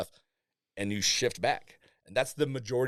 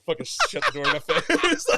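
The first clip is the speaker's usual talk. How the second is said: louder, laughing through the words; loud laughing delivery; 7 semitones higher, 7 dB louder than usual. Real, not acted. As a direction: laugh while telling it. Laughing at the story.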